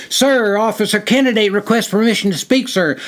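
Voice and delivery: high, loud formal shout; exclaiming. A narrator's reading. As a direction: shout the request into his face. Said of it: "and exclaimed".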